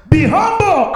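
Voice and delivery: shouted command. Real, not acted. Shouted repeat of humble.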